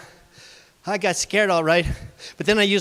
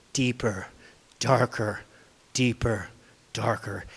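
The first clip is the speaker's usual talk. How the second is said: spooky hush; 9 semitones lower, 5 dB quieter than usual. A narrator's reading. Storyteller sets spooky mood.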